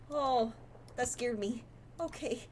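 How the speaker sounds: ordinary talk, nothing marked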